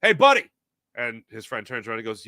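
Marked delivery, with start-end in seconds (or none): 0.0-0.5 calling out. Retold or imitated. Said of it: "hears Christopher McDonald go".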